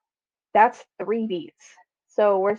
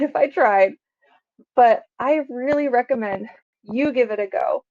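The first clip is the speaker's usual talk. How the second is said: ordinary delivery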